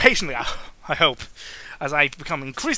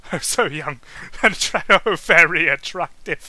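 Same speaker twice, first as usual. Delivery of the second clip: giggling, louder; amused giggly aside; laughing; 7 dB louder than usual. Real, not acted. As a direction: laugh through the gushing line. Reader reacts laughing to character.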